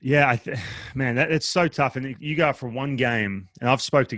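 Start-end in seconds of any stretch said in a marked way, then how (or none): none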